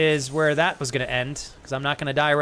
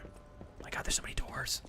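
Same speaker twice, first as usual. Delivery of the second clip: hushed, much softer whisper; dismayed whisper; 16 dB quieter than usual. Real, not acted. Whispering in dismay at the many doors.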